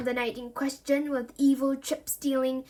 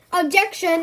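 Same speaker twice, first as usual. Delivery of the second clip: raised-voice courtroom protest; loud objection; 5 semitones higher, 8 dB louder than usual. Acted. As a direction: call out high and loud. Narrator calls out an objection.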